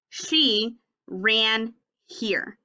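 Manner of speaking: very slow, each word apart. Shown as a demonstration. Teacher modeling slow reading for class. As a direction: read it word by word, pointing. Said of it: "Read it with me".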